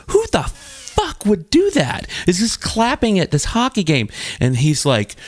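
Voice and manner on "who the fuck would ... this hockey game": incredulous outburst. Retold or imitated. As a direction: demand it in mock outrage, voice pitched way up. Retells own outraged reaction at bar.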